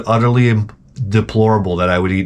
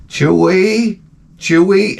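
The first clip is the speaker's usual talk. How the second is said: higher, questioning call; calling the dog; 7 semitones higher, much slower than usual. Acted. Owner calling his entranced dog, questioning call. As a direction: draw out the name, pitched up.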